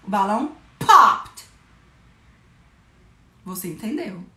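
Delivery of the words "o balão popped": loud exclaim. Shown as a demonstration. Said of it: "a gente faz com a voz".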